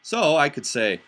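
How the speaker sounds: ordinary delivery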